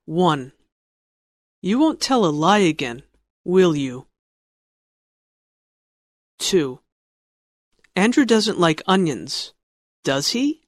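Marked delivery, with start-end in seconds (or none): 1.6-4.1 "will you?" falls at the end; falling tune on "will you?"; falling tag question. Shown as a demonstration. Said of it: "decide if they have a falling intonation or rising intonation". 10.0-10.6 "does he?" rises sharply at the end; rising tune on "does he?"; rising tag question. Shown as a demonstration. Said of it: "falling intonation or rising intonation".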